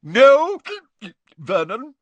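put-on very high, loud, drunken protest; tipsy aunt waving him off; hiccuping Aunt Marge. A narrator's reading. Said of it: "hiccuped Aunt Marge".

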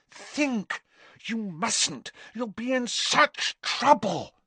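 high, anxious urging; pleading Hermione. A narrator's reading. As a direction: beg him, half-breathless. Hermione begging Harry.

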